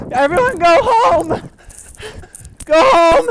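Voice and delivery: loud desperate yell; shouting. Acted. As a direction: yell it into the wind. Yelling at storm outside.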